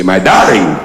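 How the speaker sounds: low, gentle my darling; a hint of a lovesick groom; lightly put-on groom. The groom to his bride, lightly voiced. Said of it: "You say".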